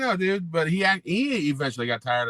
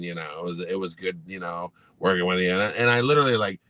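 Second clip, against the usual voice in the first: ordinary delivery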